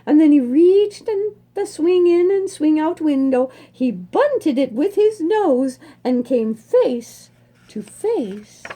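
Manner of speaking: ordinary delivery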